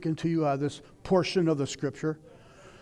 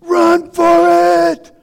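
very loud, much higher, dragged-out mock scream; panicked scream; 13 semitones higher, 13 dB louder, much slower than usual. Preacher voicing panicked worldly man, screaming and stretched out. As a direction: scream it like a man fleeing a sinking ship. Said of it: "Man is screaming".

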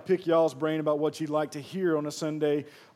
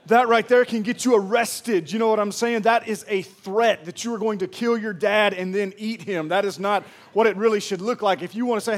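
ordinary delivery

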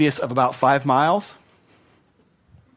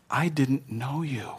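slow, much softer solemn verdict; solemn Jesus; 7 dB quieter, slower than usual. Acted. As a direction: let it land slowly, hushed and grave.

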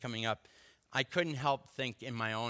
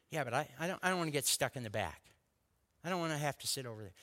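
rushed, anxious excuses; fast fretful protest; much faster than usual. Retold or imitated. Husband fretting to wife about back seats, rushed. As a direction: gabble it out like a worried husband.

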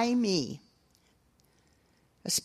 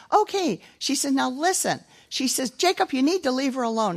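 niece scolding; 6 semitones higher than usual. Retold or imitated. Niece lectures the boy.

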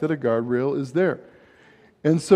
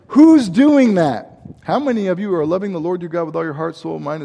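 ordinary delivery

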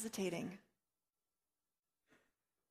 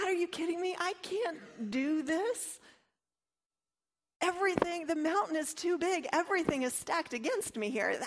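Jeremiah's inner voice protesting in disbelief; panicked inner voice; 7 semitones higher than usual. Retold or imitated. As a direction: balk at a task that feels far too big. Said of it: "inner voice that's saying".

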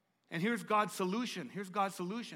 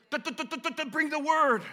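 rapid stuttered da-da burst, then louder; machine-gun the syllables, then blurt the line; mock stutter, higher; imitating a stutter; 7 semitones higher, 7 dB louder than usual. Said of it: "I stutter".

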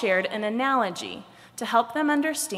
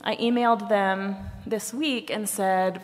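plain reading voice

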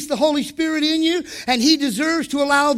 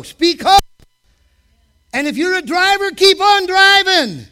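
slow, high, loud preacher's call; preaching at full force; 5 semitones higher, 4 dB louder than usual. Acted. Preacher charging the church to speak hope, shouting. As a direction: command them to speak hope.

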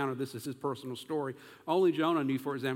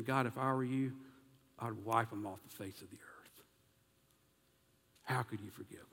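much softer and lower, a bitter grumble under the breath; resentful inner voice; 5 semitones lower, 7 dB quieter than usual. Acted. Believer telling God privately he'd wipe enemies out, soft and bitter. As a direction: say it quietly like a grudge you'd never admit aloud. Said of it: "it's almost as if we're saying".

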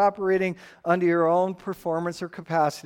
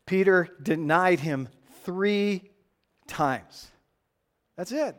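normal talking voice

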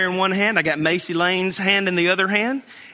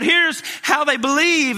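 mock scream; 9 semitones higher, 4 dB louder than usual. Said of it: "And so he screams out".

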